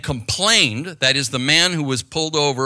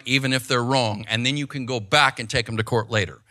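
normal talking voice

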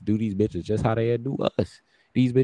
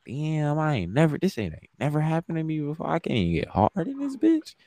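pitched-up incredulous complaint; retold disbelief; 7 semitones higher than usual. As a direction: voice climbing high in stunned disbelief. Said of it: "I'm like".

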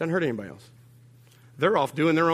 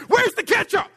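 shrill, loud hammed-up yell, much higher; mock outburst; 6 dB louder than usual. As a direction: blow up over the ketchup in mock fury. Exploding at missing ketchup.

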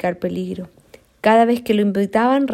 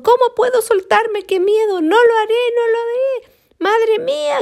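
high, panicked cries; cling on and cry out in fright; terrified crying out; 13 semitones higher, 3 dB louder than usual. Acted. Koala clinging to the falling tree: high frightened cries. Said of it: "gritaba y se aferraba".

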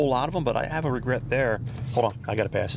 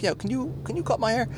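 much higher-pitched self-quote; acted-out request; 12 semitones higher than usual. Husband asks his wife for a haircut, high mock plea.

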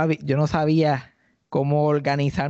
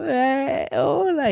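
awkward greeting; 10 semitones higher, much slower than usual. Retold or imitated.

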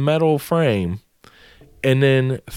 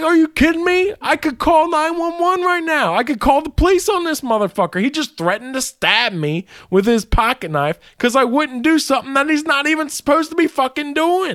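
high, indignant rant at HR; ranting in disbelief; outraged; 13 semitones higher than usual. Retold or imitated. Venting to HR about the knife threat, high and outraged.

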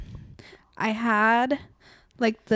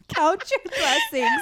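laughing; slower than usual. Real, not acted. Laughing retelling the comment.

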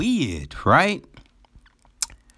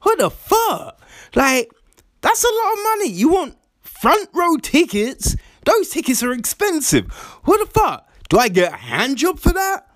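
mock outrage; 12 semitones higher than usual. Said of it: "And I was like".

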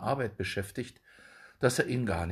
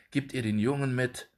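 ordinary delivery, nothing marked

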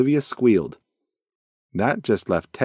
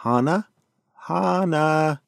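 calling out; 7 semitones higher, slower than usual. A narrator's reading. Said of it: "Even Teddy called out".

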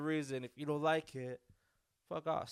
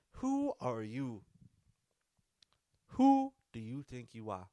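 Denzel voice; 5 dB louder, slower than usual. Retold or imitated. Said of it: "you gave your Denzel voice".

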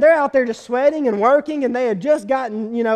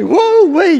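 woo-wee exclamation; 6 semitones higher, 8 dB louder than usual. Real, not acted. Preacher reacting to God killing Uzzah.

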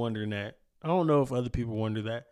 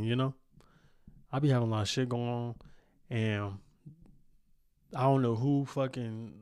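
ordinary delivery

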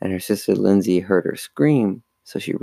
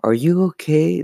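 high-pitched, urgent check on someone; concerned question; 8 semitones higher than usual. A narrator's reading. Lindsay repeats her question to Chris, concerned. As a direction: ask with raised pitch and concern. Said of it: "Lindsay repeated the question".

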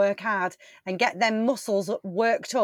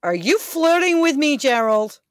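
loud startled question; 5 semitones higher, 5 dB louder than usual. Acted. Lumberjack asks, loud.

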